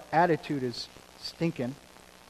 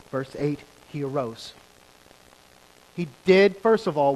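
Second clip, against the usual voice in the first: normal talking voice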